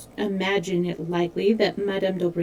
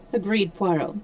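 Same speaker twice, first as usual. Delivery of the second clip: ordinary delivery, nothing marked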